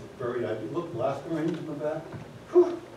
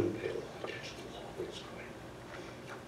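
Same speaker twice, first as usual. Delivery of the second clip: whispered aside; 16 dB quieter than usual. Real, not acted. Conspiratorial aside.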